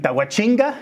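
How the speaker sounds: slow Brazilian pronunciation with a chin sound; Brazilian accent. Acted. Guest echoing the place name, Brazilian accent. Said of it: "imitar exactamente el acento brasileño".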